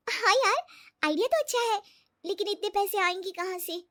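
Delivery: high-pitched, interested but doubtful; hesitant friend Riya. Acted. Riya to her friend, wondering about the money, hesitant.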